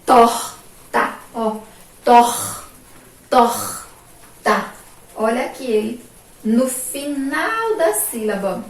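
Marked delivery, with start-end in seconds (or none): 0.0-1.2 "Torta" split as tor, ta, loud first syllable; syllable separation. Shown as a demonstration. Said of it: "A separação silábica". 2.0-4.7 "Tor-, torta" with tor isolated and loud; syllable separation. Shown as a demonstration. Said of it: "A separação silábica".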